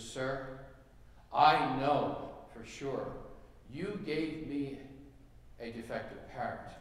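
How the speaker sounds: drawn-out words with pauses between phrases; measured, emphatic accusation in a joke; slow, deliberate complaint. Acted. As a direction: spell out the grievance slowly, phrase by phrase. Lady's final complaint to store manager.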